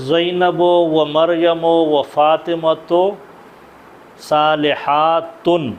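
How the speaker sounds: very slow, صالحاتن drawn out syllable by syllable; slow dictation. Shown as a demonstration. Teacher dictating the feminine plural to students, very slowly. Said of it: "teacher spells out صالحاتن ending".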